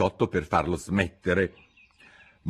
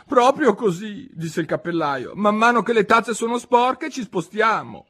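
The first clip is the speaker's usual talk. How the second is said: Hatter; 14 semitones higher, 4 dB louder than usual. Acted. Hatter voiced.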